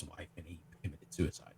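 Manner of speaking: dropped to a whisper; hushed, almost inaudible. Real, not acted. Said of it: "you whispered and it cut out again".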